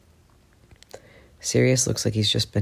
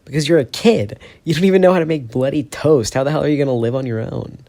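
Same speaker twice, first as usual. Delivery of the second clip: loud exasperated yelling, higher; angry shout; 5 semitones higher, 7 dB louder than usual. Acted. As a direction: tear into him, loud and exasperated. Heated quarrel between friends.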